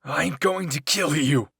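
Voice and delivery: loud, drawn-out shout of rage; furious yell. A narrator's reading. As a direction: roar it with fury, slow and full force. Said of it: "Eren yelled while furiously trying".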